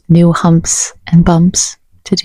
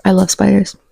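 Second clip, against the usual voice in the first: ordinary talk, nothing marked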